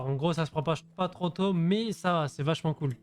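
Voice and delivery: ordinary delivery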